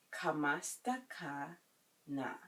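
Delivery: slow "Kamusta ka na?" with a pause before the last word; slow teaching repeat. Shown as a demonstration. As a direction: draw it out for learners. Teacher breaks phrase up for learners.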